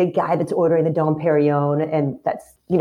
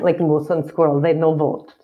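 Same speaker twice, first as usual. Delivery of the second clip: hammed-up cartoon Russian accent; mock Russian accent; 2 semitones lower than usual. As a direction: play a comic Russian accent through a laugh. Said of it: "Okay, Russian".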